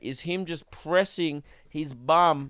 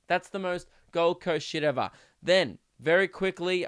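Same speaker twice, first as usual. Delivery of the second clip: ordinary talk, nothing marked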